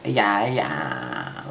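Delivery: low-pitched, deadpan yes; deadpan admission. Retold or imitated. Answering friends before they finish asking, low deadpan admission. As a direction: drop the pitch and cut in with a yes.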